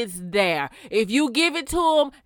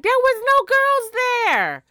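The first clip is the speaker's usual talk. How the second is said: mock Cole; 12 semitones higher, 3 dB louder than usual. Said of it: "Cole was like".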